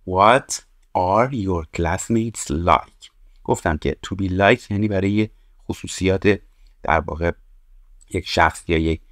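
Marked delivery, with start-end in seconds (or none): 0.1-3.1 slow model reading. Shown as a demonstration. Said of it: "teacher reads model question".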